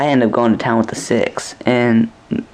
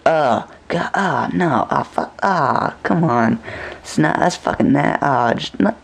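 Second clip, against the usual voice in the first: higher, groaning in disgust; disgusted protest; 3 semitones higher than usual. Retold or imitated. Dreamer groaning at the couple beside him, disgusted. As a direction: recoil from what you're seeing. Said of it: "and I'm like".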